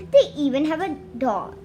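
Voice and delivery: usual speaking voice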